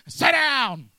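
mock Jesus shouting at a fretting believer; Jesus commanding. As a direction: cut the panic off cold. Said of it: "Jesus cutting off the worrier".

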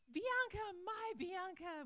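loud, high over-familiar hello; hammed-up, drawn-out affection; mock gushing greeting. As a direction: gush over someone like a long-lost friend. Hypothetical over-familiar greeting of new acquaintance.

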